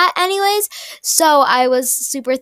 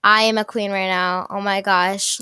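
ordinary delivery

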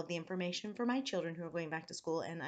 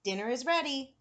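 raised, higher-pitched call; 6 semitones higher, 6 dB louder than usual. A narrator's reading. Grandfather announces dinner to grandson, calling out. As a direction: call out to the next room. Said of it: "grandfather called out".

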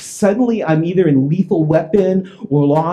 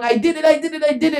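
high mock yell, no extra volume; retold yelling; 11 semitones higher, faster than usual. Calvin on his lawn chair confessing, mock yelling. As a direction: imitate a frantic repeated confession, high. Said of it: "with his duffel bag yelling".

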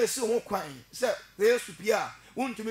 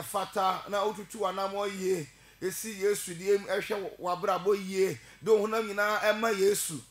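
ordinary delivery

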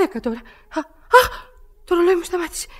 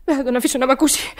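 ordinary talk, nothing marked

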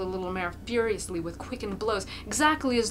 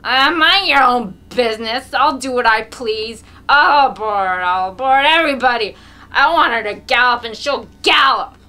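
put-on drunken defiant yell; 4 semitones higher, 16 dB louder than usual. A narrator's reading. Mokolka rages at critics.

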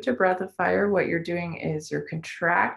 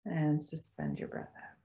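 guided meditation; 11 dB quieter than usual. Acted. Guides breath holds softly.

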